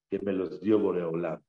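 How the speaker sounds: ordinary talk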